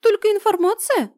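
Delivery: high squeaky incredulous question; squeaky little creature asking. Acted. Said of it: "уточнил Блау".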